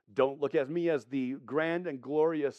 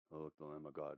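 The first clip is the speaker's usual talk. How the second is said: very low, barely audible mumble; passive reading along; 13 semitones lower, 20 dB quieter, faster than usual. Shown as a demonstration. Preacher showing how we sometimes read the text, passively. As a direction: read it with no emphasis at all. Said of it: "I'm just reading along".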